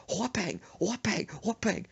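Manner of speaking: high "whoopang", three in a row; cartoon volley of blows; repeated smack sounds. Imitated blows back and forth.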